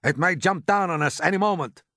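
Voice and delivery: high, urgent order to the men; yelled warning. A narrator's reading. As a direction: warn them off loudly. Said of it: "yelled the chief of police".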